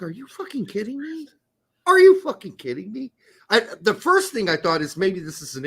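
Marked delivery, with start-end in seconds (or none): 0.0-1.3 high, soft incredulous aside; hushed disbelief. Retold or imitated. Retells own disbelieving reaction. 1.9-3.1 high, loud disbelief; loud incredulous outrage. Retold or imitated. Repeats own outraged reaction, escalating.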